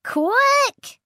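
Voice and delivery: very high shriek; Tricky shrieking a warning to hide. Acted. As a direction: shriek in sudden fright. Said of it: "shrieked Tricky".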